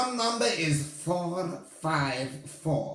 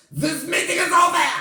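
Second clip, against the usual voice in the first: loud, high witch shout; 10 semitones higher, 7 dB louder than usual. Acted. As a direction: bark the meeting closed. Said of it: "she shouted".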